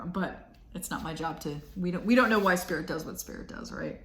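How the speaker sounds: usual speaking voice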